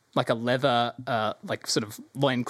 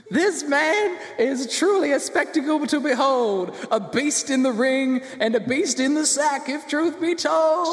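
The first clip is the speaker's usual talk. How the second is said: ring announcer; 12 semitones higher than usual. Acted. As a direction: proclaim the champion like a fight announcer. Announcer presents the champion.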